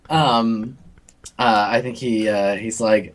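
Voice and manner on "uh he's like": ordinary delivery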